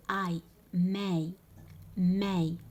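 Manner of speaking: ماي rhyming with English "my", each word set apart; ai diphthong. Shown as a demonstration. Teacher models the diphthong for learners, ماي with clear ai. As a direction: say ماي with an open a gliding into i. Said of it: "يتنطق اي".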